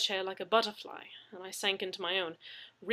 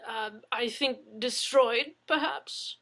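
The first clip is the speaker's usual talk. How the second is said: hesitant offering; 4 semitones higher, 7 dB louder, slower than usual. Acted.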